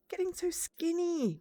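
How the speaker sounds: high, drawn-out motherly complaint; fretting mother. Acted. Mother frets over thin child, high complaint. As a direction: stretch it out in a high fretful voice.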